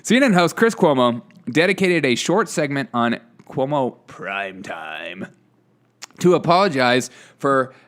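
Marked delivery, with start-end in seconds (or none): 3.5-5.3 deep, hushed show-title voice; hammed-up low announcer; mock show announcer.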